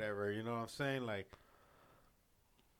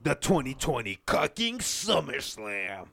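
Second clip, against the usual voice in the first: promo announcer; 5 dB louder than usual. Pretend. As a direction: announce it like a wrestling event. Announcing a mock show title.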